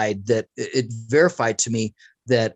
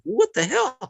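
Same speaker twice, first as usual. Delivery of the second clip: higher startled yelp; shocked; 10 semitones higher than usual. Retold or imitated. Speaker reacting to his girlfriend throwing a knife with a startled yelp. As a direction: caught off guard by a thrown knife. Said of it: "I was like".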